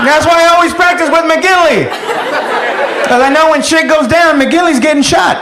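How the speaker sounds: high-pitched, insistent yelling; worked-up hollered explanation. Acted. Soldier justifying himself to his sergeant, worked-up holler. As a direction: holler your excuse, voice pushed right up.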